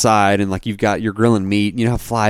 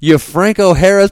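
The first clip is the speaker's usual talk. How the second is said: high, loud mock yell; imitated yelling; 10 semitones higher, 7 dB louder than usual. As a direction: yell it like an angry dad, in imitation. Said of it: "your, your dad yelling".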